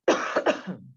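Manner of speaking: single real cough; dry cough. Real, not acted. Speaker coughing mid-lesson.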